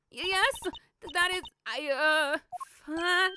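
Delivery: high, stammering and flustered; about to faint. Acted. Witness stammering to the agent, faint with nerves. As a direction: falter high, words breaking up. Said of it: "she just faints".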